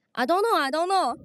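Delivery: mock-panicked English excuse; nervous fluster. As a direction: fumble it out like a panicked girl. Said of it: "我那时候太紧张了".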